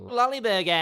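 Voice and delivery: high, long-held last syllable; silly exaggeration. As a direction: blare it out high and stretch BURGER. Plays with silly name, stretched out.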